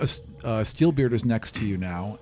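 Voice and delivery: ordinary talk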